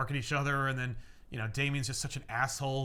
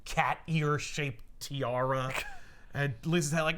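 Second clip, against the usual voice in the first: normal talking voice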